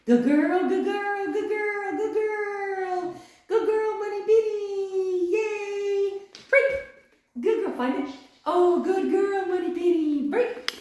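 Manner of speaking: very high, loud gushing praise; high excited praise. Real, not acted. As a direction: gush over the dog. Praise run with dog.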